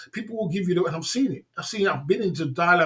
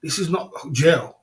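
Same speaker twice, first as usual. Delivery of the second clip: ordinary talk, nothing marked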